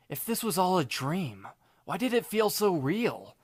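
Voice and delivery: ordinary delivery, nothing marked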